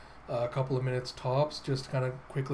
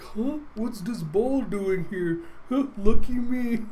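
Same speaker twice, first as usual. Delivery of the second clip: goofy lucky racer; 7 semitones higher, 4 dB louder, slower than usual. Acted. Teagle finds the ball.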